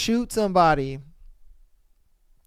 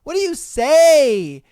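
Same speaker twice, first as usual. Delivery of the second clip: emphatic; 7 semitones higher, 8 dB louder, slower than usual. Real, not acted. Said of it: "Please comment below".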